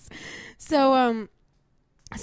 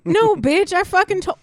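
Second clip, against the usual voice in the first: angry rant; 11 semitones higher, 7 dB louder than usual. Real, not acted. Ranting about ex's message.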